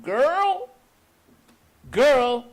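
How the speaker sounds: very high, loud searching call, slow on the last. Acted. Nurse searches the smoke for the flower girl, high loud calls. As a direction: cry out into the smoke for a lost girl. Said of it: "she started calling".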